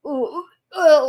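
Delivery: gagging "ooh, uh", ready to be sick; nauseous retching groan. Retold or imitated. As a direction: gag and groan at the stench. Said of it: "like this, 'cause I'm heavily pregnant".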